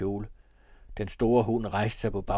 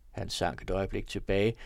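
ordinary delivery

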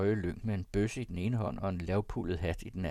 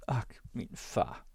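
slow, whispery sigh; sighed lament; 2 semitones higher, 3 dB quieter, slower than usual. A narrator's reading. Frightened young woman sighing over her father, whispered. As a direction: breathe it out softly as a slow sigh. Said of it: "sukkede hun".

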